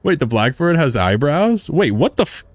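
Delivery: high, rising amazed disbelief; surprised. Real, not acted. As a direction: gasp it out in disbelief. Startled discovery.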